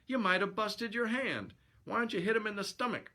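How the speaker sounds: high-pitched shipmate voice; high, nagging pal; scolding sidekick. Acted. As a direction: fuss at the fighter in a high voice. Bill scolds his boxer pal.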